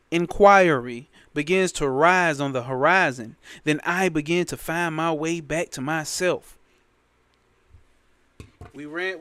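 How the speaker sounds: usual speaking voice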